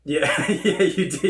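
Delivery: laughing agreement; giggling yes. Real, not acted.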